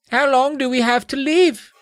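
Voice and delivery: put-on very high, small child voice; squeaky, put-on fearful question; tiny trembling voice. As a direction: ask it as a tiny frightened child. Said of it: "in a tiny trembling voice".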